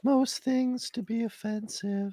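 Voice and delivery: slow, very high-pitched cartoon voice; high, dragging mope; Strong Sad impression. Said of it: "Strong Sad is the".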